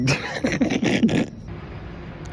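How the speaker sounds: high breathless giggle; wheezy laugh. Real, not acted. Laughing at his own singing, a high wheezy giggle. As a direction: giggle without voice.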